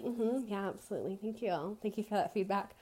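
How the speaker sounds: softly, politely placating an angry customer; fake polite murmur. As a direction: hush it down to a meek customer-service murmur.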